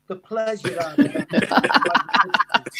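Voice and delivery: laughing. Real, not acted. Laughing while speaking.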